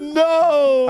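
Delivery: high, loud mock wail; distraught child. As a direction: cry out as a horrified kid. Voicing child's horrified refusal.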